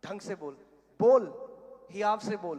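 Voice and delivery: acted scolding that jumps up in pitch and volume; imitated scolding. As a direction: order her to say it boldly. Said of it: "उन्होंने डांट लगाई".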